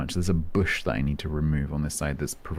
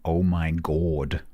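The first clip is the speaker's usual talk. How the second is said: put-on old London accent; much slower than usual. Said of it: "older relatives all saying".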